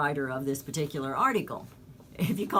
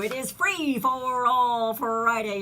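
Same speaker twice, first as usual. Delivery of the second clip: drawn-out, higher radio announcement; announcer flourish; 5 semitones higher, 4 dB louder, slower than usual. Real, not acted. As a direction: stretch out each word like a show opener. Host announces the show's theme day.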